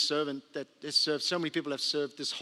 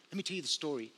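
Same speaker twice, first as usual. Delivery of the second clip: softer repeat; 3 semitones lower, 9 dB quieter, faster than usual. Retold or imitated. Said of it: "And she says".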